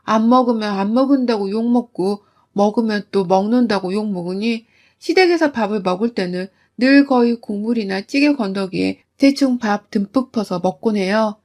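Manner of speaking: normal talking voice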